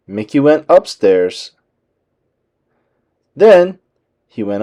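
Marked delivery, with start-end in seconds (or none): none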